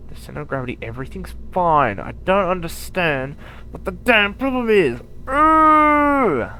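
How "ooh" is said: high, loud exasperated yelp; sudden loud squeal of annoyance; frustrated yelp. Real, not acted. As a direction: let out a sharp cry of frustration. Frustrated outburst at flipping rocket.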